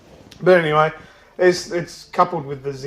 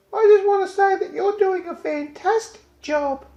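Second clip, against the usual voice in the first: put-on much higher, small child's voice, eager; little kid; 4 dB louder than usual. Kid tugging at the announcer's attention, high childlike voice. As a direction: pipe up like a little kid trying to get attention. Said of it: "And the kid goes".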